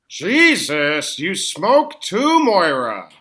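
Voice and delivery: high, loud and slow; aghast, nearly squealing; shocked outburst. Acted. Spits out gin in shock.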